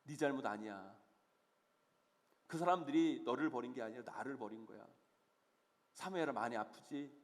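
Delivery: low, soft, consoling voice; God's gentle comfort. Acted. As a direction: console the hurting softly, with pauses between phrases. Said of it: "그런 사무엘을 하나님은 위로하십니다".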